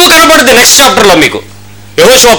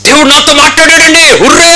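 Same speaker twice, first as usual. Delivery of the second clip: gleeful boast; 8 semitones higher than usual. Retold or imitated. Man boasts God spoke.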